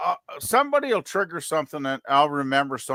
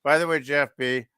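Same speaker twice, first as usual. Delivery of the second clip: usual speaking voice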